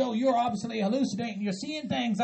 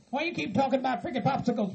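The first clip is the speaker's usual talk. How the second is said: ordinary delivery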